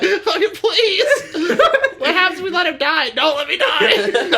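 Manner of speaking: high, loud, slow laughing wail; squealing begging through laughter; mock desperate pleading. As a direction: plead for your life, hammed-up. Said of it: "I'm like".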